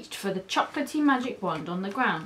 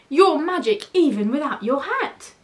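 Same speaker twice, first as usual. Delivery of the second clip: higher, louder admiring praise; excited cry; 5 semitones higher, 5 dB louder than usual. A narrator's reading. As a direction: tell him joyfully how amazing he is. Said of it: "cried the cats".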